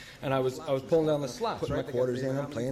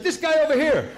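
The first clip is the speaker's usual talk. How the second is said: loud, high laughing call; 11 semitones higher, 6 dB louder than usual. Retold or imitated. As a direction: shout him out to the room, laughing. Calling out, pointing at Kenny.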